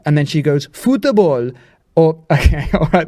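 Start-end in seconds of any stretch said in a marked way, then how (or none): 0.7-1.5 put-on football with a Korean accent, an extra vowel inside; mock Korean-accented football. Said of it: "say it with a Korean accent".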